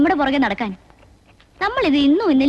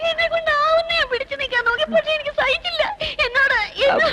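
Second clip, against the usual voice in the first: high-pitched tearful wail; crying; 11 semitones higher than usual. Acted. Woman wailing her complaint to the men, crying. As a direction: break down wailing, words pushed out high. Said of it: "അയ്യോ, കരയാണോ?".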